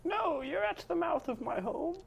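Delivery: put-on high old woman voice; a prim granny, up high; old lady voice. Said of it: "old lady voice".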